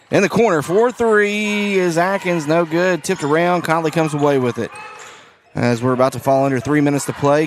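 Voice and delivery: play-by-play. Acted. Announcer calling the late fourth quarter, livelier play-by-play. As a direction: ride the action up.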